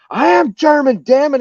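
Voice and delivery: very high indignant holler; reenacted outraged shout; retold yelling back. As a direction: yell it back in a high, protesting voice. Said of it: "I yelled back at Michael".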